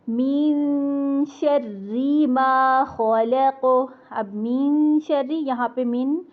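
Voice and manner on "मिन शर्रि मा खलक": slow melodic tajweed chant of कुल अऊज़ु बिरब्बिल फलक; Quran recitation. Shown as a demonstration.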